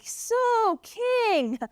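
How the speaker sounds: high, eager servant voice; a servant sheepishly tipping off the king; high-voiced servant Harbona slipping the king some news; tattling servant. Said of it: "And he's like".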